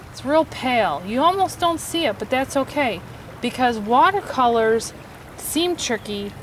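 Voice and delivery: ordinary delivery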